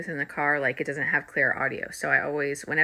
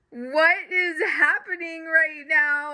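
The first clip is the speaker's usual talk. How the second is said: bewildered disbelief; 10 semitones higher, 4 dB louder, slower than usual. Retold or imitated. Retelling bewildered reaction, laughing.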